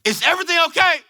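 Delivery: acted panic, calling down the phone; anxious shouted concern; alarmed.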